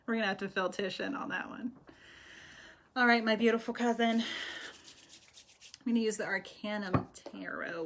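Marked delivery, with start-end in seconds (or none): none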